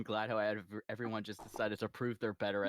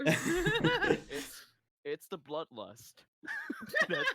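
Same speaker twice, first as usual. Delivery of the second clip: laughing; 8 dB louder, much slower than usual. Real, not acted. Laughing about bloodlust.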